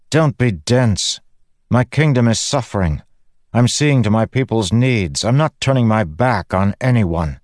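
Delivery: queen rebuking her challenger; Fen, indignant queen. Acted. Narrator voices Fen arguing back.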